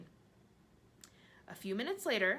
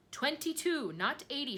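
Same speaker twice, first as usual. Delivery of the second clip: higher, louder shriek; 9 semitones higher, 5 dB louder than usual. A narrator's reading. Earthworm shrieks to correct the centipede, high and loud. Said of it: "shrieked the earthworm".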